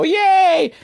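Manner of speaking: very high, loud mock cheer; excited kids. Kids cheering Dolph's Trojan horse lesson, imitated excitement. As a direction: cheer like a roomful of little kids. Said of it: "all the kids are excited".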